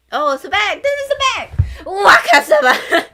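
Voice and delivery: thrilled fan yelling a character's name; excited shriek. Real, not acted. Fan spotting her favourite character in the illustration, squealing excitedly.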